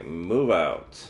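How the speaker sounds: usual speaking voice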